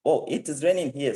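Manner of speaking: mildly amazed, reliving the moment; retold surprise. Recalling first sight of rain in Atlanta, surprised. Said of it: "I was kind of surprised, like".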